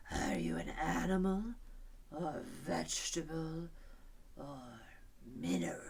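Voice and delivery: slow, breathy drowsy lion, yawns between words; yawning lion. A narrator's reading. Lion yawning at Alice, slow and drowsy. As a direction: yawn through the question. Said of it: "yawning after every other word".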